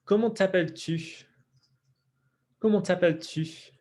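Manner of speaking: French tu with rounded lips in t'appelles-tu; pronunciation model. Shown as a demonstration. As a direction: model comment t'appelles-tu for learners to repeat. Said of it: "let's do some pronunciation work".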